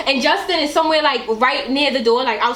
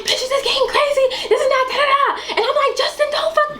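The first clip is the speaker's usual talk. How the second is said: high-pitched outburst; 10 semitones higher than usual. Retold or imitated. Retold drunk outburst.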